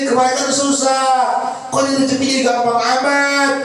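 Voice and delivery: high, earnest pleading to God; pleading prayer. Acted. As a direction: beg God like a frightened servant. Preacher voices tearful prayer to God. (doa memelas dengan suara tinggi)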